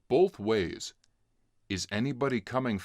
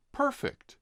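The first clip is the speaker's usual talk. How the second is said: very high, delighted perfect; pleased perfect; 14 semitones higher than usual. A narrator's reading. Narrator finding the resting log, high delight. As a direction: sing it out, delighted.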